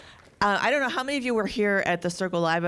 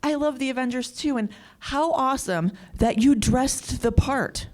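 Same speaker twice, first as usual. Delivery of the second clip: higher, excited fan voice gushing; 8 semitones higher than usual. Retold or imitated. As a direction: gush like a thrilled superfan. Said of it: "and she was like".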